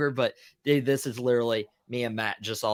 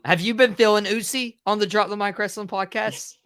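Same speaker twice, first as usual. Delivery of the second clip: playful; 8 semitones higher, 3 dB louder than usual. Pretend. Playful catchphrase question.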